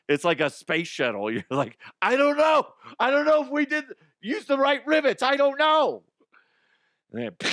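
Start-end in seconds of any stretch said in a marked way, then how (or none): 2.0-6.0 high, loud comic panic; mock panicked yell. Rider in a shaking old car, hammed-up panicked yell. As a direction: yell it like the car is falling apart.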